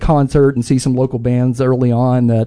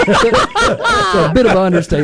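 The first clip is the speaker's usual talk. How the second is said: laughing; 8 semitones higher than usual. Real, not acted. Laughing at the deep cuts joke.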